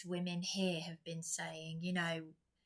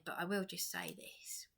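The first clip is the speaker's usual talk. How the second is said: whisper; 6 dB quieter than usual. Real, not acted. Confiding a secret about partner.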